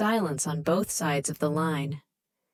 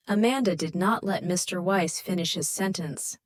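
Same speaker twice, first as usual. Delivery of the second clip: ordinary delivery, nothing marked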